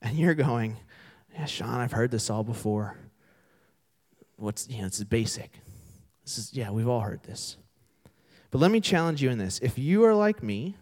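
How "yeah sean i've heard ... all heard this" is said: low, quiet, unimpressed mumble; cynical listener. Acted. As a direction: say it softly, as if you've heard it all. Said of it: "probably more cynical like me".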